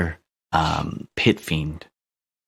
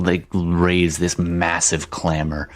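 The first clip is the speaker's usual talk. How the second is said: usual speaking voice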